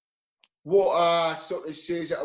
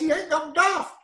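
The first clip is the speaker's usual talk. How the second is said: incredulous high-pitched retort; 11 semitones higher than usual. Retold or imitated. Telling the caller he must be taking the piss, in high-pitched disbelief. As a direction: voice jumps much higher in disbelief. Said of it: "I says".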